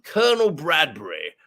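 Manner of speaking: put-on plummy old army officer reminiscing; Colonel Bradbury. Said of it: "It's just what I heard in my head".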